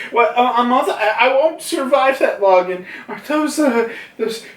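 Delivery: put-on high, stammering, frantic kid voice; panicked child. Young Yamato facing a month in the cave, acted panic. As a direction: protest in a rising fluster.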